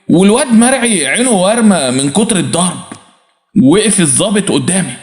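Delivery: plain reading voice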